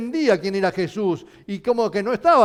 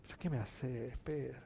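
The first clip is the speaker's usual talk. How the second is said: very soft, lowered voice, almost under the breath; a quiet, gentle reproach; hushed rebuke; 8 semitones lower, 20 dB quieter than usual. Acted. Jesus softly asks Pedro what he is doing, hushed.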